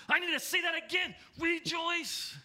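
high, exclaiming, thrilled apostle; excited. Retold or imitated. Paul insisting to his scribe, excited. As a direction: call it out, too thrilled to hold back. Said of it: "he gets so excited".

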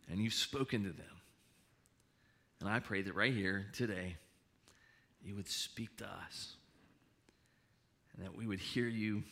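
soft, hushed pastor's prayer; quiet prayer. Acted. Opening prayer.

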